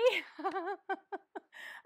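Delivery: a high, loud rising laugh; real laugh; amused laugh. Real, not acted. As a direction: laugh at the awkward memory. After retelling awkward introduction.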